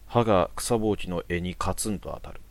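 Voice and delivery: ordinary delivery, nothing marked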